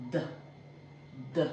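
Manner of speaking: "D" said as a very short "deh"; letter-sound demo. Shown as a demonstration. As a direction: sound out "D" as "deh", not "dee". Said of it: "D is pronounced as".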